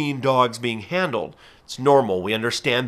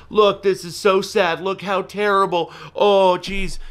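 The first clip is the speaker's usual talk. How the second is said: mock dismayed filmer; 7 semitones higher than usual. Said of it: "they're filming".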